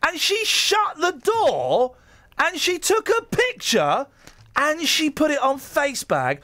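high, slow, scandalised voice; mock outrage. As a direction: ham up the shocked reaction. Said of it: "so we go".

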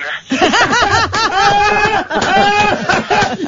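squealing laugh, can't stop; high helpless laughter. Real, not acted. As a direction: burst into high giggles. Host cracks up at clip.

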